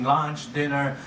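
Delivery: normal talking voice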